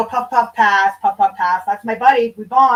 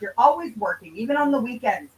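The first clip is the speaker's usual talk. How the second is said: ordinary talk, nothing marked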